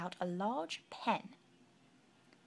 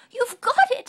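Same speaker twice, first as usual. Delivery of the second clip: much higher and louder, giggly squeal; bubbly giggling praise; 17 dB louder than usual. Acted. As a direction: giggle it out in delight. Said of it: "she giggled".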